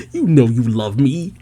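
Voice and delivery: put-on coy voice, rising at the end; playful mimicked insistence; teasing repeat. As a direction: tease it out after a laugh. Repeats Jinny's line after laughing.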